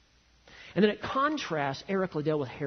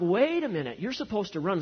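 higher, louder indignant objection; mock alarmed protest; 6 semitones higher, 5 dB louder than usual. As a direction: protest it as a startled crowd would. Voices England's shocked protest at Liddell.

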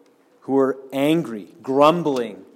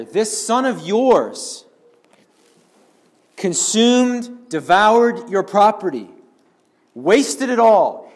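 slow, higher, louder bitter complaint; indignant outburst; 7 semitones higher, 5 dB louder, slower than usual. Acted. Older brother ranting to his father, bitter and indignant. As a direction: let the resentment boil over. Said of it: "He says here".

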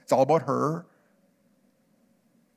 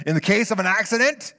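higher and louder, anxious guy; nervous traveler voice; 6 semitones higher, 5 dB louder than usual. Retold or imitated. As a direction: play the jittery flyer. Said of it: "And he's like".

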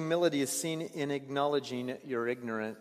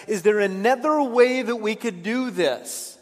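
anguished plea; 6 semitones higher, 7 dB louder than usual. Acted. Jesus pleading, voiced by preacher.

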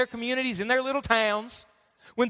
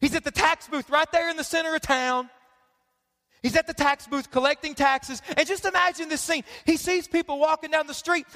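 ordinary delivery

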